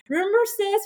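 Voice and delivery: high-pitched sisterly teasing; joking tease. Retold or imitated. Teasing sister about the hug attack, joking. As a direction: needle her playfully, pitched high. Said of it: "sometimes we make a joke".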